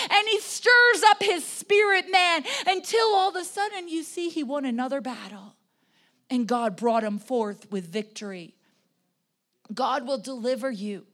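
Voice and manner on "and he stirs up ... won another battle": high, loud pulpit storytelling; impassioned preaching. Real, not acted. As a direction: drive the story on with raised voice. Preaches at full force.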